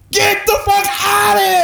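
very high, loud imitated shriek; hammed-up furious outburst; screaming. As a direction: scream it at the top of the lungs. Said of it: "She screamed".

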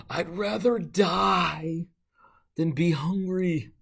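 slow, drawn-out, high mock wail; a hungry crowd whining that death beats hunger; melodramatic complaint. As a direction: overplay it like a hangry drama queen. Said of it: "It's dramatic".

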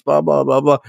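usual speaking voice

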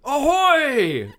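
high lookout call down from above; shouting from crow's nest. Acted. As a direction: hail the deck from the mast. Lookout calls again.

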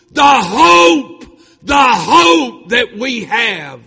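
full-force, high, drawn-out shouting; preacher's roaring cry of triumph; triumphant yell. Retold or imitated. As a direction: yell it out in victory, stretching the key words. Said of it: "I just yelled out".

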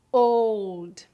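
put-on old with English diphthong. Shown as a demonstration. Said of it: "como a letra O na palavra".

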